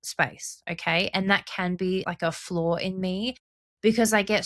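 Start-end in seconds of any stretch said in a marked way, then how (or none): none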